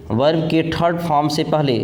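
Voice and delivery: ordinary delivery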